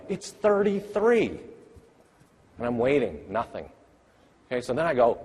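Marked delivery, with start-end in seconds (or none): 0.1-1.4 coaxing teacher. Retold or imitated. Teacher coaxing a first grader.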